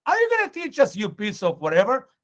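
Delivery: acted outburst, starting high and loud; rough rebuke. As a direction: scold him roughly as the Pharisees. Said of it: "they spoke pretty roughly".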